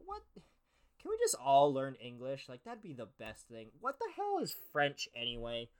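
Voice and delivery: ordinary talk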